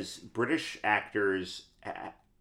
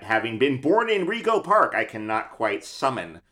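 normal talking voice

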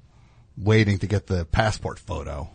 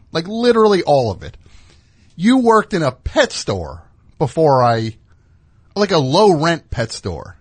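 high, loud, indignant rant; exasperated rant; 8 semitones higher, 5 dB louder than usual. Pretend. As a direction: unload on them, fed up and talking fast. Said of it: "I'd be like".

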